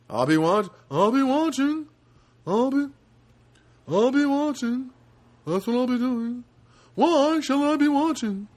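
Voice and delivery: high, loud Seinfeld impression; badly done Seinfeld, loud and high; terrible Seinfeld. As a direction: pitch it way up like a bad Seinfeld. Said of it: "That's a terrible, terrible Seinfeld".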